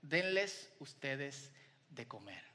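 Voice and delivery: slow, soft and low command; calm Jesus. Retold or imitated. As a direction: give the answer gently but firmly. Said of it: "le ofrece la siguiente solución". (Jesús responde despacio y con calma)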